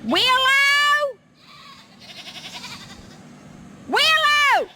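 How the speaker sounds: drawn-out farmyard holler; hollering for the goat. Real, not acted. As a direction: call her in from far off. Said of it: "I'm a holler".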